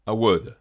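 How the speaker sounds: "Awed" with W between the vowels. Shown as a demonstration. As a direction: turn the V into W in "Awed". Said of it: "entre deux voyelles où il se prononce généralement W". (« Awed » avec un W entre les voyelles)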